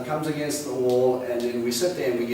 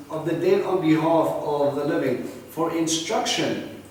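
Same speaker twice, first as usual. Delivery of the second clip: scripture reading; 4 semitones higher, 4 dB louder, slower than usual. Acted.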